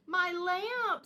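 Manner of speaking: high, loud cry of dismay; Mama Bear sees her best lamp broken. A narrator's reading. Mama Bear cries out over her broken lamp, high and loud.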